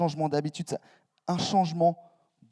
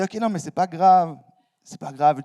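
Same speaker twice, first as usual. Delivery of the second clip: forced reassurance; 2 semitones higher, 8 dB louder than usual. Retold or imitated. Friend reassured after missed meeting.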